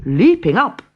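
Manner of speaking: ordinary delivery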